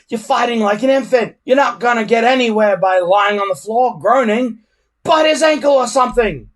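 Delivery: high, loud angry shout; exasperated shouting. A narrator's reading. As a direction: yell orders at a hopeless fighter. Gubba shouting at Hiccup.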